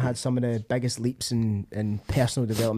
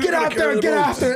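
hammed-up motivational drill yell, very high; Goggins; 4 dB louder than usual. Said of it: "then it cuts to Goggins".

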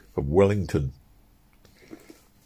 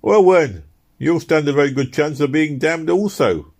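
sharp, much higher and louder retort; mocking exclamation; 7 semitones higher, 5 dB louder than usual. Young man taunting the preacher, loud mocking exclamation. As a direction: fire it back at the preacher. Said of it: "exclaimed one of the two young men".

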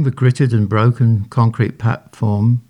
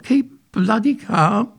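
high, slow, strained; squeaky panic; 13 semitones higher than usual. A narrator's reading. Harold mocks the advice, squeaking in panic. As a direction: squeak each word, near breaking. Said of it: "squeaked Harold".

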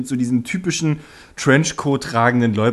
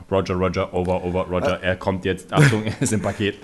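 lower, radio-style soldier voice; over walkie talkie; 7 semitones lower, 3 dB quieter than usual. Retold or imitated. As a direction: talk like over a helmet radio. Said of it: "der immer so".

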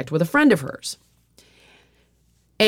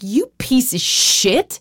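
inner fury; 5 semitones higher, much slower than usual. Retold or imitated. But deep down I was like.

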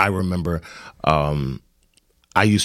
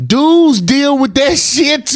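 higher, slow, drawn-out whine; high whiny complaint; 3 dB louder, slower than usual. Acted. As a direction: stretch it out like a wounded complaint. Exaggerated complaint about body image.

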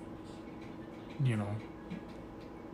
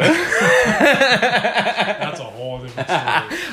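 slow, much louder words laughed out; real laughter, said much higher and louder; laughing; 9 semitones higher, 13 dB louder, much slower than usual. Real, not acted. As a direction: laugh your way through the line, amused. Laughing at the Tinder question.

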